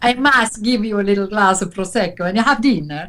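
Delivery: put-on charming Italian hotel owner's accent. Hotel owner inviting a lone guest to dinner, Italian accent. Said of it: "who's a very charming Italian".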